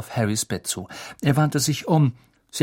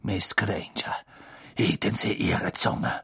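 low, hushed warning; icy, whispery reprimand; cold rebuke; 3 semitones lower, 4 dB quieter than usual. A narrator's reading. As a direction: silence them with quiet menace. Snape silences the girl.

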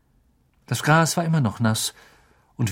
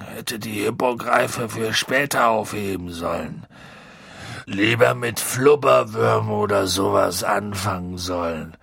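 woozy, broken-hearted lament; drunk, self-blaming Hagrid. Acted. Hagrid blames himself, tearful.

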